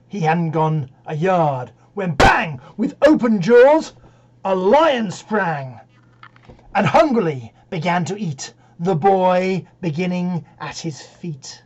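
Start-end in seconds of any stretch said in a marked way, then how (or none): 2.2-11.6 loud, explosive storytelling; dramatic climax. Acted. Reciter tells of lion springing on Jim, loud alarm. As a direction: burst out as if the beast pounces.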